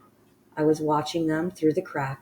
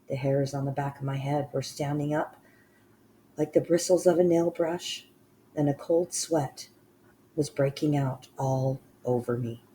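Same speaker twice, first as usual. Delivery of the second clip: ordinary delivery, nothing marked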